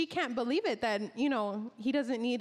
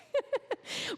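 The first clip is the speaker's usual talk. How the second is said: real high, short giggle; amused laugh; 12 semitones higher than usual. Real, not acted. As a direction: giggle after your own little joke. Laughs after joke about waiting to work.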